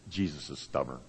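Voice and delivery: low, very soft retold complaint; subdued grumble. Son in the kitchen in the morning, quoted in a subdued grumble. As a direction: drop right down for his quiet grumble.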